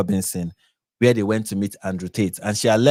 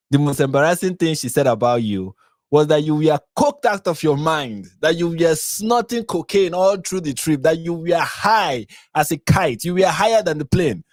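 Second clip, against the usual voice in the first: mocking; 6 semitones higher than usual. Real, not acted. Mocking Tommy's cocaine use.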